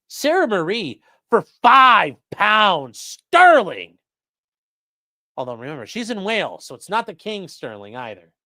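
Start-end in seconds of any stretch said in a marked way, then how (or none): 0.1-4.0 donation announcement. Real, not acted.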